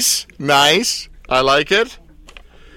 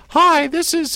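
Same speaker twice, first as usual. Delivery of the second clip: very high, put-on caller voice; slower than usual. Talk-show host playing a caller, in a very high put-on voice. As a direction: squeak it out like a made-up caller. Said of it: "now I'm gonna sound like".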